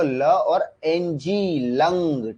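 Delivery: letters stretched out very slowly; slow, deliberate spelling. Shown as a demonstration. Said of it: "लंग देख लो".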